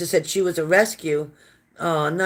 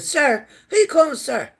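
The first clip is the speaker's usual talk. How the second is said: indignant retort; 9 semitones higher than usual. Retold or imitated. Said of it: "I said".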